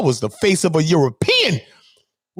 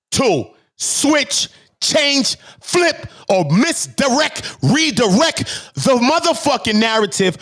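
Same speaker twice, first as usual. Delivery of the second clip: high, punched words with gaps between; emphatic vow; 6 semitones higher, slower than usual. Real, not acted. Streamer vowing the narrative will not change, punched word by word. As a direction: punch "switch", "change", "flip" one by one.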